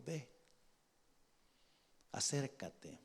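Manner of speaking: very soft, unhurried prompting; hushed command. Acted. God sending David into the camp, hushed command. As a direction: softly urge him forward.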